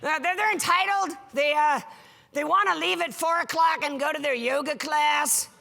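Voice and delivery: frustrated executive rant. Retold or imitated. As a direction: vent like a stuffy boss fed up with millennials. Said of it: "they're, like, super frustrated".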